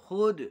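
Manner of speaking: खुद with the वाव barely heard; demo reading. Shown as a demonstration. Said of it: "वाव को बिल्कुल साफ करके नहीं पढ़ा जाएगा". (वाव खींचे बिना खुद पढ़ना)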